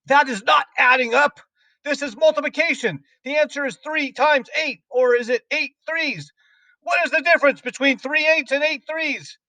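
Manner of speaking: very high, strident scolding; enraged headmistress demanding an answer; yelled tirade. A narrator's reading. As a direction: rant at the pupil in a high, furious voice. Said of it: "yelled the Trunchbull".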